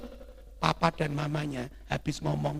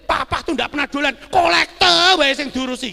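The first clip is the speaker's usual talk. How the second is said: loud, high-pitched mock protest; indignant whine, played big; child complaining; 13 semitones higher, 14 dB louder than usual. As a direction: imitate a fed-up kid griping about dad. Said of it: "waktu itu anaknya komplain".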